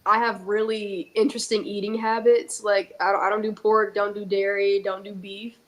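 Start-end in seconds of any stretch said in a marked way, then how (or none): none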